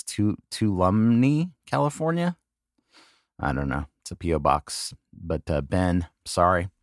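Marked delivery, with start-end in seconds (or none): 0.0-2.4 TUOLUMNE drawn out slowly, CALIFORNIA lifted high like a question; unsure reading. Real, not acted. Host stumbling over a town he can't read, unsure and questioning. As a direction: sound out TUOLUMNE haltingly, rising high on CALIFORNIA. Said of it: "I can't even read this".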